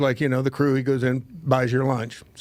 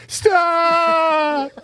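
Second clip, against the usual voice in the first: high, loud, crying shout of "Stop"; panicked shout; 14 semitones higher, 6 dB louder than usual. Retold or imitated. Terrified kid screams at the ride operator, high and loud. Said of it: "he goes".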